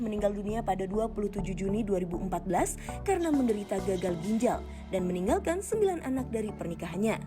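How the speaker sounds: usual speaking voice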